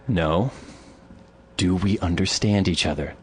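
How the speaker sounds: low, controlled refusal; firm retold warning. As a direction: put your foot down without raising your voice. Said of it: "So I turn around again".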